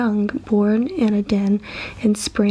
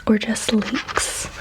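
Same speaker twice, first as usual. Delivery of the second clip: ordinary delivery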